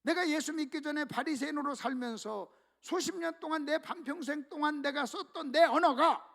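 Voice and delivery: normal talking voice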